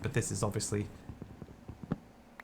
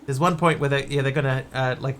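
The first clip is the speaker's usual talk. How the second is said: usual speaking voice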